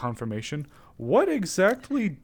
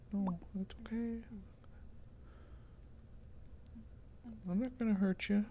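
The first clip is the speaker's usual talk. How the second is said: softer, hushed, dropping to a whisper at times; soft soothing coax; 3 semitones higher, 13 dB quieter than usual. Real, not acted. Luring the dust bunny out, hushed soothing voice. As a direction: soothe a frightened animal, barely above a breath. Soothing skittish creature softly.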